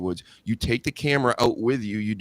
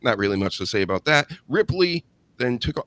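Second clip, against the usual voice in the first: normal talking voice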